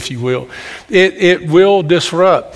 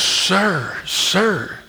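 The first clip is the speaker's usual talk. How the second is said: higher, softer imitation of a lady; hushed spa lady; 4 semitones higher, 5 dB quieter than usual. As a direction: mimic her discreetly getting his attention. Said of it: "this lady came running up to me".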